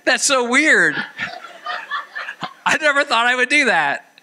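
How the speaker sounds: high-pitched mock astonishment; amazed at himself. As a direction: marvel at yourself in a high voice. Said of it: "sometimes I go like".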